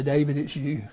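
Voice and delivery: low, soft, hushed prophet's words. Shown as a demonstration. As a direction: let it drop to a hush. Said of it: "repeated, later hushed".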